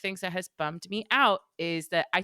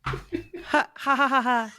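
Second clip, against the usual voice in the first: hammed-up villain cackle; evil laugh; 6 semitones higher, much slower than usual. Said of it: "That's my evil laugh".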